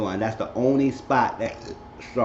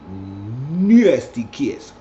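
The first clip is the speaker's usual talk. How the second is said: drawn way out, swelling louder; relishing; 6 dB louder, much slower than usual. A narrator's reading. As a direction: stretch NASTY slow and juicy. Relishing the kiss in the telling.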